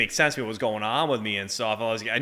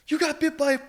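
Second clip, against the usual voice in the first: high mock yell in a put-on voice; mock drill sergeant yelling at a recruit; imitating a drill sergeant's scream; 14 semitones higher than usual. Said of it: "He's screaming at me".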